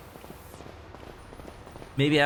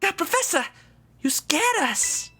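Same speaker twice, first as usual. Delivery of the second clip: put-on high startled kid voice; slower than usual.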